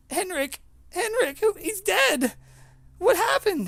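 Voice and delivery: very high, loud horrified cries; screaming woman. Acted. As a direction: cry out as a woman finding her friend dead. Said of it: "She's like screaming".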